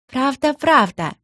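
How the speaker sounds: put-on high, slow childlike agreement; eager little one. A narrator's reading. Younger brother backs up the older one, eagerly agreeing. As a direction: chime in like a small kid. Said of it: "закивал мелкий".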